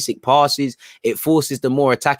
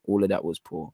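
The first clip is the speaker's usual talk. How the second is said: quiet repeat; 6 semitones lower, 8 dB quieter than usual. Real, not acted. Fan muttering his verdict on Walker to himself, quietly. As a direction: lower and softer, muttered to himself.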